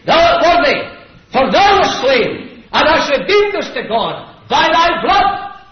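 loud, high, exultant proclamation; preach. Real, not acted. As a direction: declaim the hymn of praise at full force. Proclaims the song of heaven.

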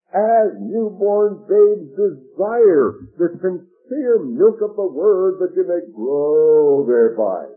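loud, very slow, each phrase drawn out; emphatic scripture proclamation. Real, not acted. Preacher urging young Christians to read the Word, proclaiming loudly. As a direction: proclaim the verse with weight, stretching the key words.

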